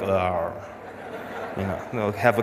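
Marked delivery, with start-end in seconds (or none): 0.0-0.5 camel grunt. Retold or imitated. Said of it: "Camel will be like".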